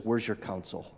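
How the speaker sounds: quiet stern judge question; a hint of a stern judge demanding. Judge sternly demands his lawyer, low and quiet. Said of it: "sternly says".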